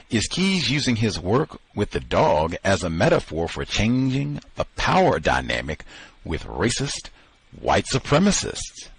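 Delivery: ordinary talk, nothing marked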